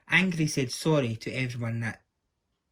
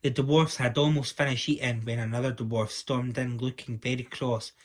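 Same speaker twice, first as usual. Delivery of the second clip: usual speaking voice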